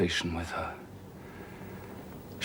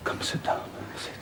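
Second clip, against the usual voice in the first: much softer, near-silent pleading; coaxing whisper; 12 dB quieter than usual. Acted. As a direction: coax her gently to stay. Rochester coaxes Jane softly.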